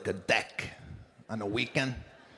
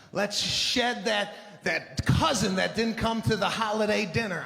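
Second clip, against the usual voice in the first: overly happy instructor; 3 semitones higher than usual. Retold or imitated. Spin teacher hyping the Monday class, mock over-happy. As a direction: pump up the room like a too-cheerful coach. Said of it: "way too happy".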